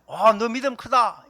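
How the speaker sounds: slow, loud, proud praise of a disciple; Jesus praising great faith. Retold or imitated. Jesus praising a disciple's faith, slow and loud. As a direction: boom out the praise, slowly. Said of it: "예수님이 이렇게 얘기하잖아요".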